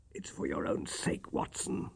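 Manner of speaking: hoarse, breathless whisper; croaked. A narrator's reading. Dying Holmes warning Watson away, croaking in a whisper. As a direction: barely get the words out. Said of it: "he croaked".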